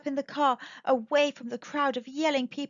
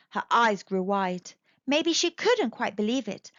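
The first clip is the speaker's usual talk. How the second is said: ordinary delivery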